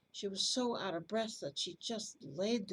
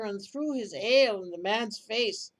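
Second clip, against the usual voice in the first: usual speaking voice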